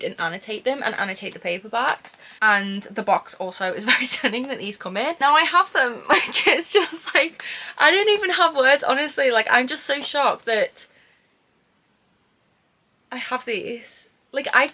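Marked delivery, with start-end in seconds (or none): 5.2-9.3 high, louder, laughing through the words; overwhelmed laughing. Real, not acted. Thanking the gift giver on camera, high overwhelmed laughing. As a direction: giggle helplessly, choked up with joy.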